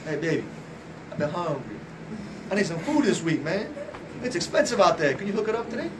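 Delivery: soft, low sweet-talk to a wife; coaxing husband. Acted. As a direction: act out gently wheedling for a favor.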